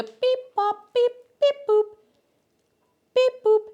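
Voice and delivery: high robotic beep boop. Pretend. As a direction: chirp it like a robot. Beep boop sound play.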